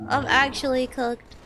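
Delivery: ordinary delivery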